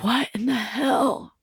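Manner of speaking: hushed, stretched-out acted dismay; retold bewilderment. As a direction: draw it out quietly, baffled. Retells bewildered moment in pickup line.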